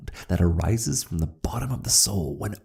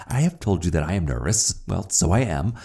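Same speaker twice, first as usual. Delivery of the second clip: plain reading voice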